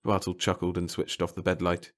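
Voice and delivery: ordinary delivery, nothing marked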